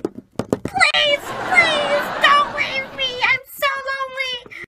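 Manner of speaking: high, drawn-out begging; desperate pleading. Acted. Becky begging her crush not to leave, high pleading wail. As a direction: wail it as a hammed-up plea. Begs departing crush to stay.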